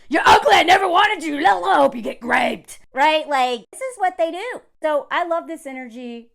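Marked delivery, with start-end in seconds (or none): 0.1-2.8 loud, high outburst; mock angry tirade. Rejected Tinder men replying, mock angry shouting. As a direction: lash out loudly in a hammed-up tantrum. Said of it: "they're like".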